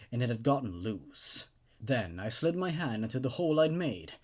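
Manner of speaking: low, measured storyteller's voice reading a horror tale; creepypasta narration. A narrator's reading. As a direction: read it darkly, keeping the listener uneasy.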